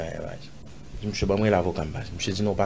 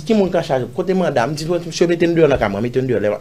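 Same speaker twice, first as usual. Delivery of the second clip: higher, louder re-enacted protest; retold indignant challenge; 7 semitones higher, 6 dB louder, faster than usual. Man retelling his challenge to police at his house, indignant. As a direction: challenge the officers, pitched up and forceful.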